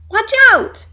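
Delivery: high, loud warning yell; shout. Acted. Raccoons shouting a warning at the woodpecker. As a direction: shout a warning to the falling bird. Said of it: "shouted Stumpy and Roxy".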